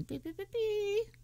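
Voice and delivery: high squeaky beeps, dropping quiet; owl beeping. Retold or imitated. Describing the owl on the card, high bird beeps. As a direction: chirp it like a tiny owl. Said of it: "a little owl going".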